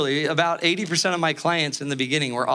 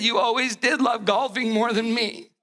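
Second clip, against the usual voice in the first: higher, hammed-up wounded complaint; mock sad wife; 4 semitones higher than usual. Wife accusing her golfing husband, mock sad. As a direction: imitate a hurt, pouting spouse. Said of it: "And then she got sad".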